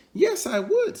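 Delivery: very high, small creature voice; little bush deer. Acted. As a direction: squeak it out, tiny and bright. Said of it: "said little bush deer".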